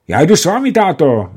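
high, loud exclaim to father; young Toník calling to his father that he'll come too; eager call. A narrator's reading. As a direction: cry out to go along. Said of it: "zvolal Toník".

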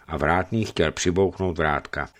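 plain reading voice

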